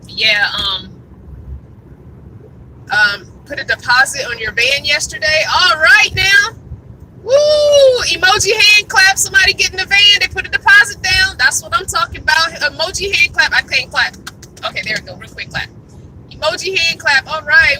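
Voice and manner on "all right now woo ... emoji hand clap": loud, high whoops of joy; cheering. Real, not acted. Vlogger cheering a viewer who bought a van, loud and high. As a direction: celebrate the news at full volume.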